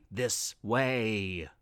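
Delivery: low, soft, eerie beckoning voice; a sinister whisper luring them on; dark voice. A narrator's reading. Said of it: "voice beckoned".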